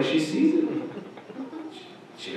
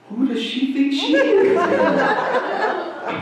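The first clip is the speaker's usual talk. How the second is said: high, laughing indignation; indignant inner voice; much slower than usual. Retold or imitated. Volunteer offended by the sushi boss, high indignant mock. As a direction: bristle at the insult, laughing. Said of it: "you think to yourself".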